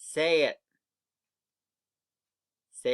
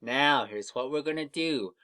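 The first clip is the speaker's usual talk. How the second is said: ordinary talk, nothing marked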